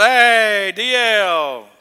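loud mock drunk hollering across the street; a hint of a rowdy drunk calling out to the preacher. Drunk passerby hails street preacher, loud mock drunken call. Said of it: "The drunk walks by and goes".

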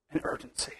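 preacher hushes the congregation on the final word, whispering; sudden whisper. Real, not acted. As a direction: drop to a breathless whisper for emphasis. Sermon climax drops to hush.

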